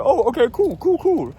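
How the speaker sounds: imitating a chummy officer, high-pitched; mock buddy-buddy. Agent cozying up to a traveler from Cancun, mock buddy-buddy. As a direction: overdo the friendly "cool, cool". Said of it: "trying to be all buddy-buddy with me".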